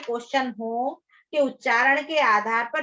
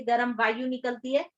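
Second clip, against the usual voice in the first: ordinary talk, nothing marked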